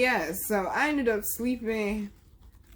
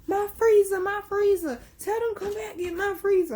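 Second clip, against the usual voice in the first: put-on high frantic old lady voice, way up in pitch; high-pitched begging granny; grandma pleading; 14 semitones higher, 5 dB louder than usual. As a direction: squeal it like a panicked granny losing her freezer. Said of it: "and Granny was like".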